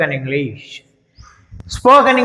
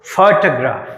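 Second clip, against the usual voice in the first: Photograph with a strong ఫో, weak remaining syllables; stress on first syllable; 5 semitones lower, slower than usual. Shown as a demonstration. Teacher modelling for students, first syllable of photograph stressed. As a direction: punch the opening ఫో of photograph. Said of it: "చూడండి, ఫో ఎట్లా పలికానో".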